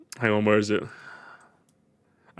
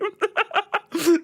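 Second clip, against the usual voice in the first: high-pitched laugh. Real, not acted. Reacting to video.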